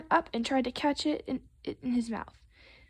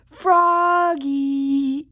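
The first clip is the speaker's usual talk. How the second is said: long, loud stretched-out holler; sustained storybook shout; dad's yell; 3 semitones higher, 8 dB louder than usual. Acted. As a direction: bellow the name through the house. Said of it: "yelled Dad".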